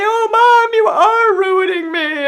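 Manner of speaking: very high, loud mock wail; hammed-up daughter wailing back in despair; mock tearful. Imagined daughter to mom, tearful mock wail. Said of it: "then we would just cry together".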